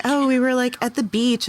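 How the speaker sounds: put-on high, breezy girly voice; girly Stacy voice. Stacy brushing off her friend's question, high and airy. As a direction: brush it off like an airy teen girl.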